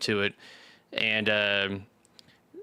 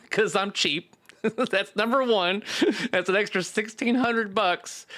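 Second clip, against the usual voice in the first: higher, slow, amused; laughing; 9 semitones higher than usual. Real, not acted. Joking about being cheap.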